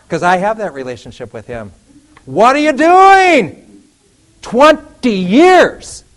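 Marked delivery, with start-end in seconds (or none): 2.2-6.1 high, loud, slow mock outrage; mock booming outrage. God demanding an answer from the preacher, loud and drawn out.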